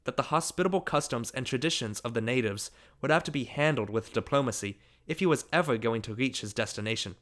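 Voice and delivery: ordinary delivery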